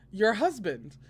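playful narrated yell, lightly done; laughing yell. A narrator's reading. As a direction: call it out cheekily to someone far off. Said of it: "he yelled with a laugh".

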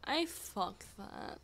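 ordinary talk, nothing marked

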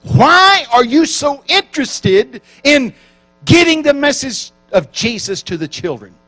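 high, loud, slow demanding challenge; hostile interviewer. Retold or imitated. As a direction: press him hard like a hostile interviewer. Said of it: "the woman doing the interviewing is very hostile".

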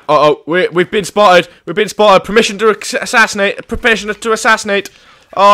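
usual speaking voice

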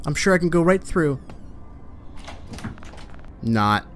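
normal talking voice

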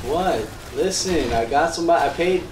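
ordinary talk, nothing marked

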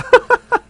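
burst of laughter. Real, not acted. Laughs during the fight.

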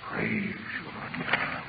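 very low, soft, slow murmur; prayerful aside, dropped almost to nothing; hushed praise. Real, not acted. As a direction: murmur it privately to God, slowly.

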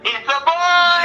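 drawn-out, high joyful shout; excited announcement. Real, not acted. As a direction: shout out the big news, stretched long.

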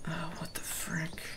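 hushed, instinctive whisper. Real, not acted. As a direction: whisper in alarm at what's nearby. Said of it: "That I'm whispering, it's just like an instinct".

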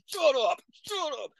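high, sharp shut up; snapping at the screaming girlfriend; angry bark. Retold or imitated. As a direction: bark it at her.

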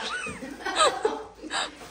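high, loud burst of laughter; laughing. Real, not acted. Recording the family vlog, high laughing.

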